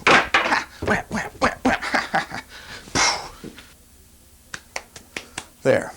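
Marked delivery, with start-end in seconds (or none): 0.1-3.4 whispered whap punch sounds; fake punch noises. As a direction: fight sound effects with huffs. Mock fight sound effects.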